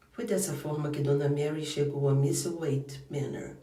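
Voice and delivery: plain reading voice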